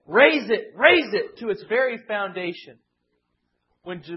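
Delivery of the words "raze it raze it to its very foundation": louder, higher cry of raze it; loud mock battle cry; hostile shout. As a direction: call for destruction like a jeering enemy. Quoting Edomites' cry against Jerusalem.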